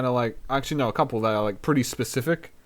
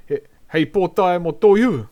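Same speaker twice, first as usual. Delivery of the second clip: louder teasing clap back; loud comeback; 6 semitones higher, 8 dB louder than usual. Shown as a demonstration. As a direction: snap back at him playfully. Playful comeback to a guy.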